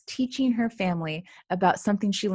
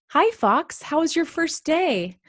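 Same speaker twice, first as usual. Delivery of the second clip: put-on 1 friendly kid voice; 7 semitones higher than usual. Kid greets new student.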